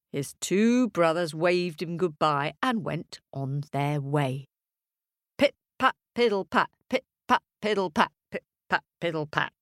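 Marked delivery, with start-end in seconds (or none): none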